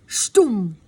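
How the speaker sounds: loud voiced slam; door slam sound. Narrator voicing the bedroom door slamming, loud slam. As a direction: punch the slam noise out hard.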